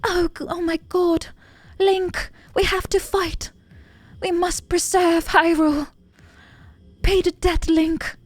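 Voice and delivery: high, distressed princess plea; damsel princess voice. Acted. Gamer voicing captured Zelda pleading to Link, high and dramatic. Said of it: "is she British nowadays".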